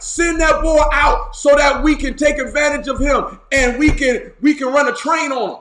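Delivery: rowdy, insistent demand; demanding shout. Acted. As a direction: holler orders through the door. Mob demands the guest.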